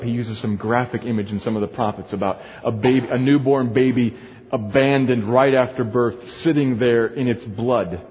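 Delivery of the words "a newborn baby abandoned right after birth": ordinary talk, nothing marked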